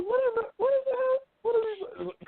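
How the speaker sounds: way up high, nearly a cry; son's hammed-up shriek at mother's flag talk; squealing disbelief. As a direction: shriek it in mock horror. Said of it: "I'm like".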